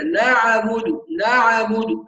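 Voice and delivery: نعبد said as naʕabudu with a vowel after the ayn. Shown as a demonstration. Teacher demonstrates wrongly voweling the silent ayn. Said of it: "هذا أيضًا خطأ".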